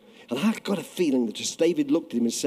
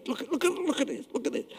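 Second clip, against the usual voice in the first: hushed excitement; 10 semitones higher, 9 dB quieter, faster than usual. Retold or imitated. God calling angels, hushed.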